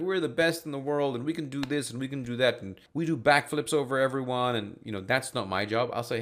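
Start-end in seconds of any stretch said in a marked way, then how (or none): 0.0-4.6 higher, louder mock boasting pitch; bragging salesperson. Salesman bragging to partner B, hammed-up boast. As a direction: puff yourself up like a braggy salesman. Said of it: "trying to be like".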